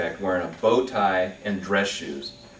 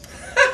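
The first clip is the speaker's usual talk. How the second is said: short laugh; 6 dB louder than usual. Real, not acted. Laughs at own joke.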